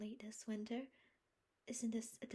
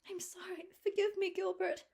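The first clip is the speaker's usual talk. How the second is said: much higher, louder distressed plea; sorrowful apology; 13 semitones higher, 10 dB louder than usual. A narrator's reading. Anne asking Gilbert's forgiveness after refusing him, distressed. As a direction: beg his forgiveness.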